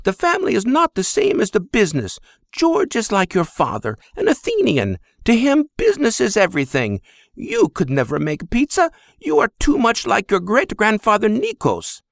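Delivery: high, sharp mother's scold. Acted. Mother scolds her son, calling his name high. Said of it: "she scolded".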